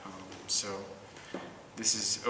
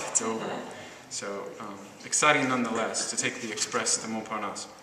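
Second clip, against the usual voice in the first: normal talking voice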